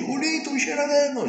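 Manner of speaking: very high, desperate plea. Retold or imitated. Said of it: "A on jeszcze głośniej". (jeszcze głośniejsze, błagalne wołanie)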